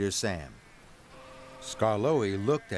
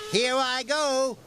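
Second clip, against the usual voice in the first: brave engine voice; 13 semitones higher, 4 dB louder, slower than usual. A narrator's reading. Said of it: "puffed Rheneas".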